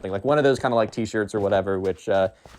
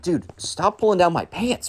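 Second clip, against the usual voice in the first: drawn-out, exasperated higher whine; mock annoyed complaint; 7 semitones higher than usual. [name] telling the emo kid to stop pranking, exasperated. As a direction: whine at the prankster, slow and fed up.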